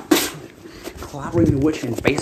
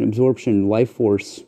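usual speaking voice

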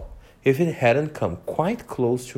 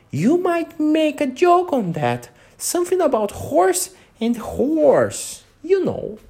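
a wee creature whispering a joke in her ear; tiny voice; 3 dB louder than usual. A narrator's reading. Tiny gnat close to Alice's ear, high and small. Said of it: "an extremely small voice close to her ear".

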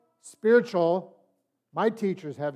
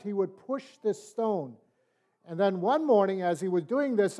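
ordinary talk, nothing marked

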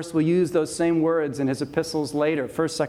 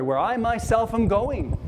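raised pitch on "I myself"; "I myself am going", pitched up emphatically; emphatic I myself; 6 semitones higher than usual. Shown as a demonstration. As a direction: lift "I myself" high for emphasis, then fall. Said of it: "it emphasizes Christ's heavenly glory".

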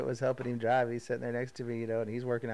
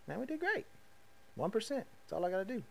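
excited; 9 semitones higher, 5 dB quieter than usual. Real, not acted. Celebrating yearly weight loss.

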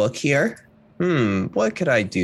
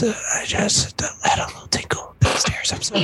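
whisper; 10 semitones higher than usual. Acted. Confessing embarrassing accident.

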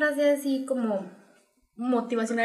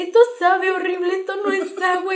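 higher, louder disgusted outcry; disgusted child; 6 semitones higher, 3 dB louder than usual. Retold or imitated. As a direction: protest the awful taste like a kid. Niña escupe agua mineral, indignada. (niña asqueada, aguda y fuerte)

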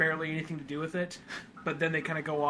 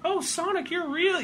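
put-on much higher awed kid voice; amazed kid; 13 semitones higher than usual. Retelling the pitch, high amazed kid voice. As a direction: play a wide-eyed kid. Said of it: "the kid meets Sonic".

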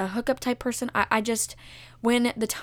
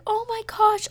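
high-pitched reenacted gasp of words; retold happy shock; 11 semitones higher, 4 dB louder than usual. Sees uncle from Ohio, high delighted shock. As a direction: replay the surprise high in the voice. Retelling seeing uncle upstairs.

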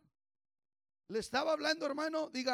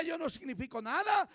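loud, rising sermon voice; fiery preaching; 4 semitones higher, 5 dB louder than usual. Acted. Pastor urging the congregation, fervent preaching. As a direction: drive the point home to the church.

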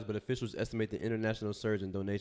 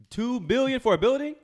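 higher and louder, incredulous at the sum; outraged disbelief; 9 semitones higher, 6 dB louder than usual. Real, not acted. Podcast host outraged at a two-billion rebuild, incredulous. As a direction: blurt out the price in disbelief. Outraged rant about cost.

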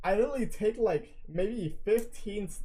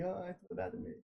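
put-on shy kid; 12 dB quieter, much faster than usual. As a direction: imitate a shy kid, much softer. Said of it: "like, a shy kid".

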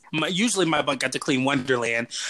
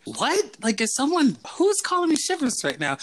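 much higher, baffled what; retold surprise; 10 semitones higher than usual. Hearing his camp nickname in a Denver restaurant, high startled surprise. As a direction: replay the startled double take, pitched up.

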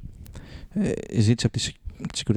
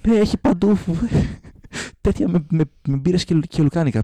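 mock guard voice; 6 semitones higher than usual. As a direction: announce it like an earnest guard. Guard agrees, voiced by teller.

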